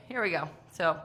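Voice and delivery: usual speaking voice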